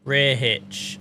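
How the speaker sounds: ordinary delivery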